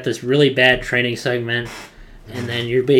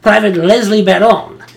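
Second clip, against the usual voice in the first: Frenchie impression; 9 semitones higher, 10 dB louder than usual. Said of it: "He's like".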